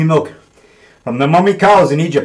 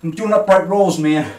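ordinary talk, nothing marked

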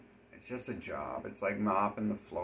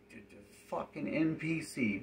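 ordinary talk, nothing marked

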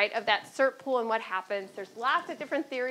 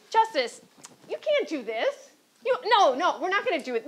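louder, higher, hammed-up pleading; playfully begging him not to, louder; mock protest; 6 semitones higher, 7 dB louder than usual. Clerks protest as the Justice tries to serve them: louder, higher mock pleading.

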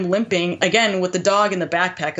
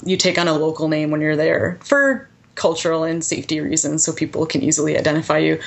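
usual speaking voice

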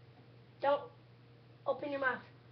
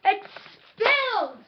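alarmed shout; 6 semitones higher, 7 dB louder, slower than usual. Real, not acted. Jelly beans spilled, alarm.

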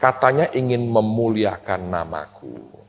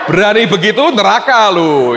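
mock warning; 7 semitones higher, 6 dB louder than usual. Mock threat to the congregation.

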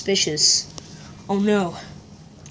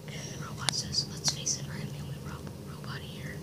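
whispering; 19 dB quieter than usual. Acted. Whispering lines to partner.

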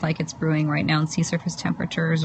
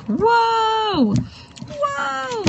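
overwhelmed whoa. Retold or imitated. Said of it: "everything's like".

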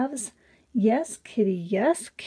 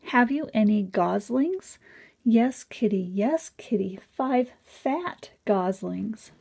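plain reading voice

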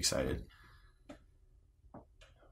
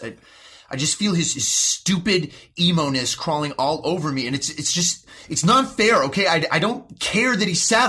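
furious shouting, much higher and louder; angry outburst; 8 semitones higher, 7 dB louder than usual. Acted. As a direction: explode in rage at the therapist. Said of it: "Responding with anger is not productive".

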